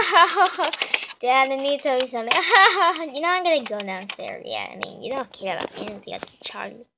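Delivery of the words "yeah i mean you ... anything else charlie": whisper. Acted. Aside, voice drops away.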